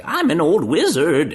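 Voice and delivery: put-on high, loud, crazy old wizard; whimsical old wizard. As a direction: go big and whimsical, an over-the-top cartoon wizard. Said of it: "some crazy whimsical show".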